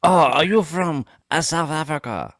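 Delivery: high, loud attempt at an African accent; a failed African accent that sounds Chinese. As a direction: put on a bad African accent. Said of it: "that's my African accent".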